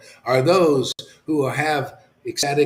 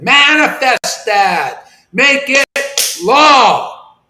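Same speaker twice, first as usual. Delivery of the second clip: slow, booming commanding shout, much higher and louder; authoritative; 10 semitones higher, 9 dB louder, much slower than usual. Real, not acted. Preacher declaring God's law to his listeners, booming authoritative shout. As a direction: proclaim it like a decree, drawn out. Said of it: "that sounded authoritative".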